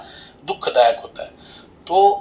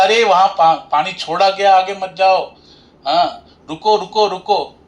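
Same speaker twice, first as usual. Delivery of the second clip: higher, louder acted call to stop; urgent warning; 4 semitones higher, 4 dB louder than usual. Husband warning his wife of released river water, acted urgent shout. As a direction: call out to halt someone heading into danger.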